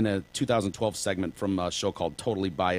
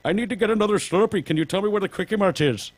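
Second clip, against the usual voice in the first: high, fast put-on Indian shopkeeper accent; sing-song mock Indian accent, high and quick; mock Apu accent; 9 semitones higher, 4 dB louder than usual. Heckler mocking a driver in a mock Indian accent. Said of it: "he's using the Apu voice".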